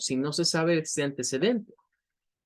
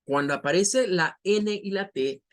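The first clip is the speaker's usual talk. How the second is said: ordinary talk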